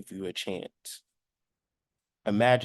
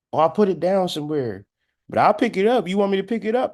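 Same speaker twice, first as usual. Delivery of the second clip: higher, louder, rising insistence; eager coat wearer; 7 semitones higher, 4 dB louder, faster than usual. Acted. Coat wearer answering the speaker about picking it up, higher and insistent. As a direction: offer it keenly in a raised voice.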